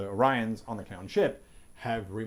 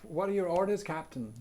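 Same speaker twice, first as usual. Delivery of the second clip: helmsman; 7 semitones higher than usual. Acted. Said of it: "says the helmsman".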